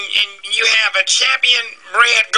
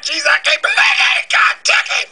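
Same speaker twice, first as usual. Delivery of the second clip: hammed-up high wail; mock wailing grief; 11 semitones higher than usual. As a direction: overplay the grief, voice breaking upward. Receptionist wails over dead doctor.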